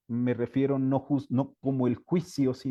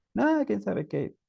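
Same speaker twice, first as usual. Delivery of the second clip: put-on sulky child; 8 semitones higher than usual. Crying child answers teacher.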